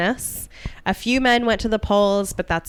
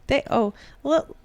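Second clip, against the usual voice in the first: attempted Oregon accent; 6 semitones higher than usual. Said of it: "what's an Oregon accent?".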